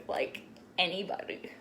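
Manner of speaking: soft unvoiced whisper; whispered aside. Real, not acted. Confiding to her viewers, whispered. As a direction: drop to a whisper.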